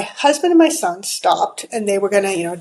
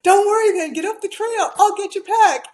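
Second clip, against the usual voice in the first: higher, urgent fatherly command; father telling his son to run up the trail, higher and urgent; urgent dad; 11 semitones higher than usual. Retold or imitated. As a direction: hurry him along with steady urgency. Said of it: "And he's like".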